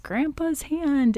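put-on a first timid try at the creepy child line; lightly mimicking a little girl's spooky line; half-hearted creepy kid impression. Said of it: "do a better impersonation".

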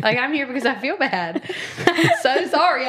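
laughing while apologizing; sheepish laughing apology. Real, not acted. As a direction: giggle through the confession. Laughing at own guilt.

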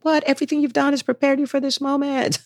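high, pumped-up reassurance; hyped-up cheerleader voice; mock encouraging gush. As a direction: cheer a nervous friend on. Encouraging peer quoted.

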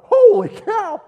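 shrieking high and loud in mock shock; shocked exclamation. Preacher acting out the king's shock, a loud high yelp. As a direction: yelp it like you've just seen the hand. Imagined reaction to writing on wall.

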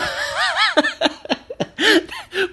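high, long burst of laughter; real laugh. Real, not acted. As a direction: crack up mid-story. Laughs at meeting Philadelphia acquaintance.

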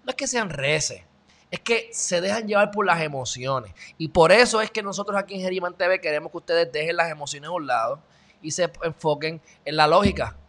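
usual speaking voice

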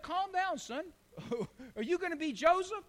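a mother humoring her son; lightly voiced mother.

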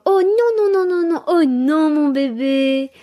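high, sweet singsong no with a smile; cutesy baby voice. Shown as a demonstration. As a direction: coo it like scolding a baby. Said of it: "avec une petite voix toute mignonne et un grand sourire".

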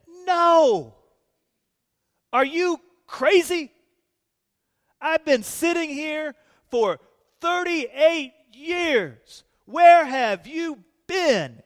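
high, loud exasperated complaint; sick man scolding Jesus, loud and indignant; indignant outrage. Pretend. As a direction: let him whine it, slow and outraged.

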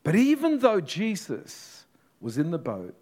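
ordinary talk, nothing marked

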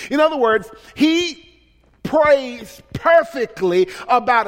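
loud, rising preacher's cadence; fervent preacher's call; preaching. Acted. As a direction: preach it out to the congregation. Preaching at full force.

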